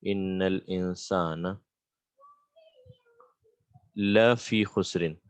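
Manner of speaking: slow, deliberate, with a long pause before "la fi khusrin"; slow verse repetition. Shown as a demonstration. Teacher breaking down a verse for students, slowly. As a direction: draw out "Innal insana", pause, then "la fi khusrin".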